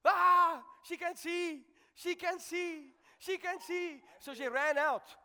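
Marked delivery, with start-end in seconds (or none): 0.0-4.0 retold joyful shout. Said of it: "I heard a woman shouting".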